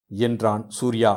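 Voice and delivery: ordinary delivery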